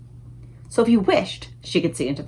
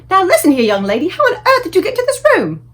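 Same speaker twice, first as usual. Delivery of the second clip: loud, high scolding yell; furious shout; 9 semitones higher, 7 dB louder than usual. A narrator's reading. Maid yelling at Sophie on the windowsill. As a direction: tear into the child. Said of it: "the maid shouted furiously".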